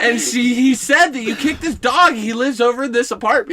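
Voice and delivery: high mom voice, arguing; insistent mother. Retold or imitated. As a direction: imitate a mother pressing her case. Mother argues with son.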